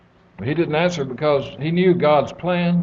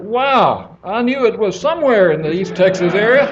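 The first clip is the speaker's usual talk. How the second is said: excited; 6 semitones higher, 7 dB louder than usual. Acted. Blonde girl excited at the punchline.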